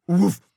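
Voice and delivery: short loud woof; a happy bark. A narrator's reading. Mooch the dog joins in with a loud woof. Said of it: "said Mooch".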